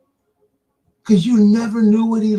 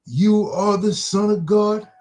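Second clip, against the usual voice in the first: slow, emphatic scripture reading, words drawn out; preacher reading a verse aloud; 4 semitones higher, 3 dB louder, slower than usual. Acted. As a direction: read the verse out slowly with rising force.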